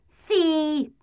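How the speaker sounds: high, cheeky yes; a playful boot overruling her. Acted.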